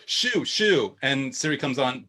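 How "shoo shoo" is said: higher-pitched mock command; shooing a dog. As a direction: chase the dog away, playfully. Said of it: "you tell your dog".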